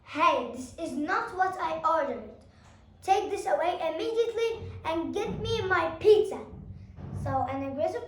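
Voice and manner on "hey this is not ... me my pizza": loud, angry demand rising; rude shout. Shown as a demonstration. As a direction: bark at the waitress, rising. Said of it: "would shout at the waitress".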